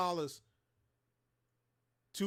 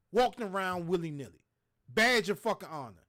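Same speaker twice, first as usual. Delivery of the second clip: ordinary delivery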